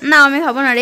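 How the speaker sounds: normal talking voice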